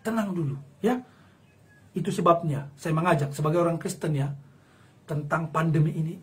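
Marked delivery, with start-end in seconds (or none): none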